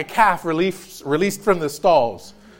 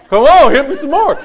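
high, eager dog begging, laughing; 6 semitones higher, 7 dB louder than usual. Retold or imitated. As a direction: pitch up for the excited dog.

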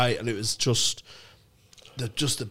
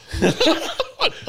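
high burst of laughter. Real, not acted. Laughing at the story.

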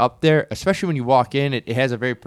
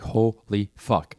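drawn out slowly, mock stunned; stunned reaction; 4 semitones lower, 4 dB quieter, much slower than usual. Friend reacting to a strong martini, retold as slowly stunned. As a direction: imitate someone floored, stretching each word.

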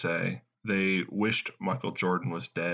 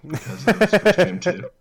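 louder, higher punchline; mock outrage; 12 semitones higher, 9 dB louder than usual. Host hitting the punchline about his family, louder and higher. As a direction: land the joke with a burst.

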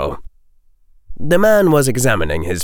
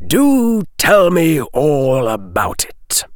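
slow, higher-pitched sardonic prompting; mocking invitation; 7 semitones higher, 3 dB louder, slower than usual. A narrator's reading. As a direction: lean on it with false interest. Mocking the potions master.

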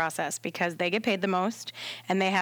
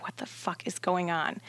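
low, softer aside, partly whispered; hushed bewilderment; 5 semitones lower, 6 dB quieter than usual. Retold or imitated. Doula calling at night, hushed bewilderment. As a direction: mutter it in private disbelief. Retelling bewildered private thought.

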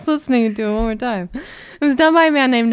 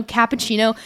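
usual speaking voice

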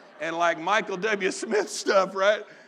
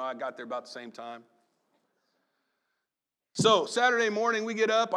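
normal talking voice

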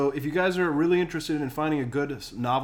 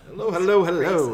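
excited; 6 semitones higher, 8 dB louder than usual. Acted. Host echoes greeting loudly.

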